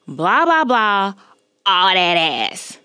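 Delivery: mock a man calling out, drawn out; slow, emphatic imitation of a catcall; imitated catcaller, very clear. Man in car to woman walking off, mocked clear catcall. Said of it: "I hear him very clearly say".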